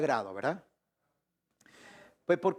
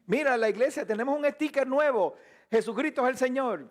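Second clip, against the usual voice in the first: higher-pitched mock church announcer; lifted, eager retold quote; imitated excited announcement; 5 semitones higher, faster than usual. Acted church member announcing new sticker.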